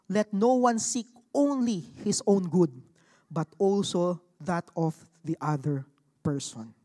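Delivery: slow, deliberate Bible reading; scripture reading. Acted. To the congregation, reading Corinthians slowly. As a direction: weigh each word for the congregation.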